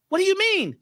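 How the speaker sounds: very high squeaky frog voice, asking; the other frogs asking what he means; puzzled frogs. Acted. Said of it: "He says".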